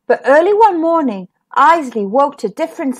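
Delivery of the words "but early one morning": ordinary delivery, nothing marked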